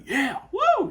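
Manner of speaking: a high, loud whoop like a partying crowd; sudden high cheer of a dancing fan; mock party whoop. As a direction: whoop it up like someone swept up in the beat. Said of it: "like, you know".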